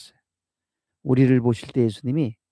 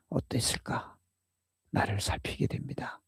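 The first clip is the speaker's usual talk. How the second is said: whispered; 10 dB quieter than usual. Real, not acted. Self-reflection in sermon.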